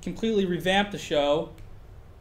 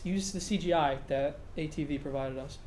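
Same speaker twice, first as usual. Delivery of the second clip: ordinary delivery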